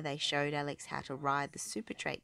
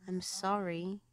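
usual speaking voice

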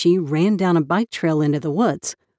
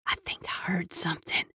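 hushed whisper, afraid to be heard; girl whispering a warning to her cousin; 9 dB quieter than usual. A narrator's reading. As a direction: whisper it so nothing hears.

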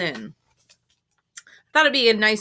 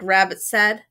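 ordinary delivery, nothing marked

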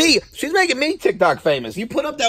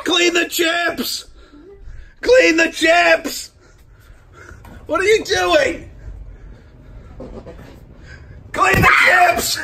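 slow drawn-out shout; 7 semitones higher, much slower than usual. Acted. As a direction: drag out each word like a drill sergeant. Repeated drawn-out command.

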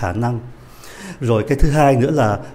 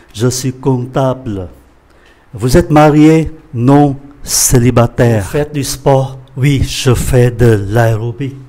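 quicker, connected French, not rushed; faster reading; 4 dB louder than usual. Shown as a demonstration. Teacher rereads the survey dialogue for students, faster reading. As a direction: read the dialogue at a more natural pace. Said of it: "Tôi xin đọc nhanh ạ".

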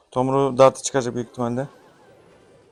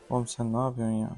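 under the breath, exasperated; quiet exasperation; 2 semitones lower, 8 dB quieter than usual. Real, not acted. Frustrated at father's bad move.

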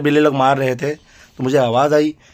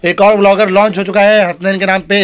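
loud, high launch of a new vlogger; excited announcement; 7 semitones higher, 8 dB louder than usual. Acted. Father proudly launching son as new vlogger, loud and high. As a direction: announce him like big news, stress और.

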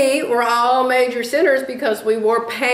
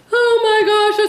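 surprised; 12 semitones higher, 3 dB louder, slower than usual. Retold or imitated. Astonished at the sweet mom.

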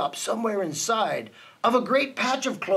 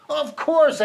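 high, loud assurance; emphatic answer; 8 semitones higher, 5 dB louder than usual. A narrator's reading. Elephant reassures the pleading mayor, emphatically. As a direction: answer firmly and at once, voice raised. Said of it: "answered Horton".

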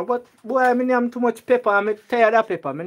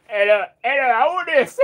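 much higher put-on Chinese accent, chuckling through it; mock Chinese voice; 4 semitones higher, 4 dB louder than usual. Answering the shopkeeper's call in a mock Chinese accent. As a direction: ham up a Chinese accent on the phone. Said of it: "change me voice".

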